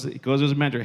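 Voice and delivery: usual speaking voice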